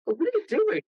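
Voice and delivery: dad woken up. Retold or imitated. Dad woken up, voiced in story.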